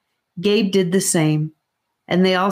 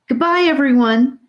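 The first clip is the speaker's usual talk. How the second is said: put-on girl voice; 8 semitones higher, 3 dB louder than usual. A narrator's reading. Girl character says goodbye.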